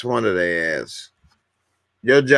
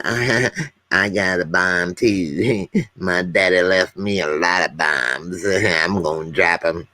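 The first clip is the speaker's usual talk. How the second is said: chuckling presidential impression, laughing between phrases; George Bush impersonation; 4 semitones lower than usual. President boasting of daddy's bombs, chuckling impersonation. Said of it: "I ain't did that impersonation".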